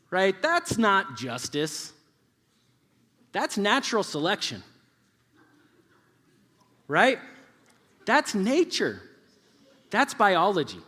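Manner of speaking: forceful declaration; emphatic preaching. Real, not acted. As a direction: drive each point home with force and pauses. Preacher drives his point home.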